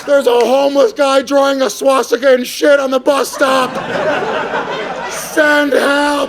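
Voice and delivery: high, slow, drawn-out yell for help; mock distress call spelling out the address; hammed-up woman screaming her exact location. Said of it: "She should have been like".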